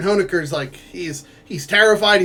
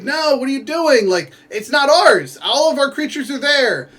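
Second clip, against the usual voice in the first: higher, urgent pleading; pleading protest; 8 semitones higher than usual. Retold or imitated. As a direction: beg him to stop, voice up high. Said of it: "Cruz is like".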